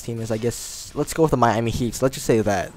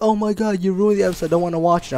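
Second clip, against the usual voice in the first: put-on upset teenage girl; 7 semitones higher, faster than usual. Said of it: "You're like".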